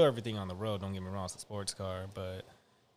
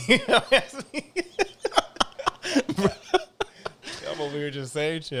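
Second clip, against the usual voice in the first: laughing; 8 semitones higher, 9 dB louder than usual. Real, not acted. Laughing at own rambling.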